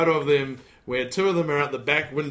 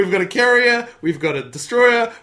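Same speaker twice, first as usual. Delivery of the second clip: excited; 6 semitones higher, 7 dB louder than usual. Real, not acted. Mock-proud list of tiny navy.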